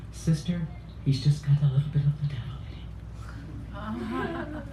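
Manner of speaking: hushed Irish father; whispering. Retold or imitated. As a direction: whisper it like a sheepish dad. Irish father quoted to nun.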